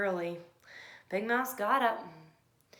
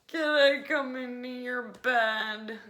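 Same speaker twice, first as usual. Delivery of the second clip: slow, louder begging; pleading little mouse; 4 semitones higher, 9 dB louder, slower than usual. A narrator's reading. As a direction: drag it out hopefully. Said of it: "asked Little Mouse".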